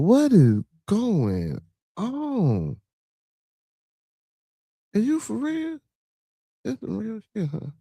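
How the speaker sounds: very slow, then high and hushed; shocked disbelief at the news; stunned disbelief. Real, not acted. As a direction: drag it out in shock. Shocked by the headline.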